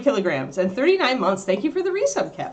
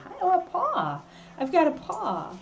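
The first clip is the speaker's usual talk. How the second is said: pet talk; 11 semitones higher, 3 dB quieter than usual. Real, not acted. Dog gives her paw.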